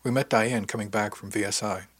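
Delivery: plain reading voice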